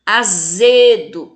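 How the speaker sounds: "Azedo" slowly, ZE loud and long; loud, drawn-out ZE in "Azedo"; tonic syllable stressed. Shown as a demonstration. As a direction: punch and hold ZE in "Azedo". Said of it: "fala com mais intensidade".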